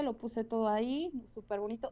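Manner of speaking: normal talking voice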